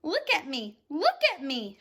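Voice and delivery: loud, eager shout; excited shout. Acted. Centipede freed from the paint, excited loud shout. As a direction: call out with delight. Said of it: "shouted the centipede excitedly".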